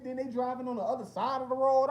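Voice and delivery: ordinary delivery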